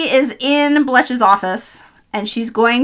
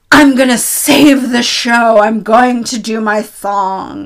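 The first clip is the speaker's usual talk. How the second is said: slow, louder mock-heroic declaration; grandly drawn-out imitated resolve; determined director; 4 dB louder, slower than usual. Director vowing to save the failing show, mock-heroic declaration.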